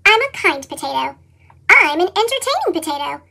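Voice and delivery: ordinary delivery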